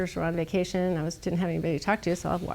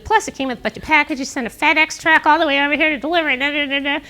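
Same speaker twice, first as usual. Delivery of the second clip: snarky; 7 semitones higher, 5 dB louder than usual. Retold or imitated. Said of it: "Sent him this really snarky".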